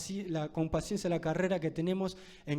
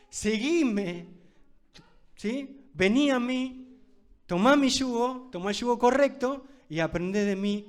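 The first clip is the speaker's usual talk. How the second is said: louder, higher preaching of Jesus' call; raised, ringing call to follow; voicing Jesus; 4 semitones higher, 6 dB louder than usual. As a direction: proclaim it like Jesus calling out to you. Preacher voicing Jesus calling believers.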